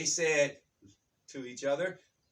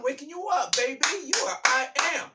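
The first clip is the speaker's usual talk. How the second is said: high, louder, fired-up wake-up call; rousing exhortation; 9 semitones higher, 6 dB louder than usual. Real, not acted. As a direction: rouse the listener with real urgency. Rousing the listeners with his message.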